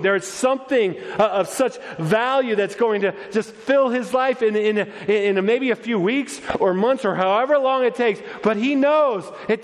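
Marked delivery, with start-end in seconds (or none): none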